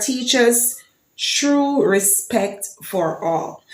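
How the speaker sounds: slow, measured recital of the anthem words; anthem recitation. Shown as a demonstration. Vlogger recites Jamaica's anthem for viewers, slow measured recital. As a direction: speak each line of the anthem slowly and deliberately.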